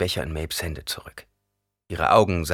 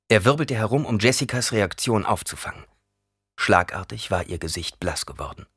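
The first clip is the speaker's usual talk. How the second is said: ordinary delivery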